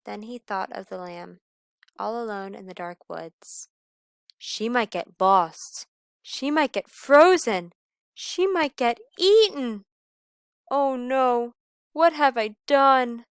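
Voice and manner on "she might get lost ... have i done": rising, louder worried wail; howled panic. Acted. Wolf fears for the lamb, wailing. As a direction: panic more with every line. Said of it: "he howled".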